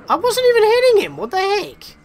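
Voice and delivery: high, loud disbelieving cry; confused outburst. Real, not acted.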